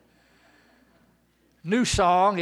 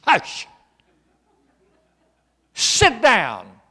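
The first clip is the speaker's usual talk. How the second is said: a hint of a stern hush, louder; hushing crowd; 3 semitones higher, 13 dB louder, much slower than usual. Bystanders silencing the blind man, mildly stern and loud. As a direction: shush him like an annoyed bystander. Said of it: "they said".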